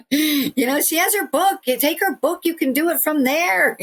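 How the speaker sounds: imitated insistent mother. Continues mimicking mother urging friends.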